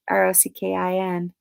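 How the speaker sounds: normal talking voice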